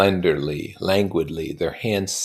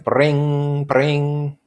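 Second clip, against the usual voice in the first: higher, louder ringing phone; mock telephone ring; 9 semitones higher, 7 dB louder than usual. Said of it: "So the phone rang".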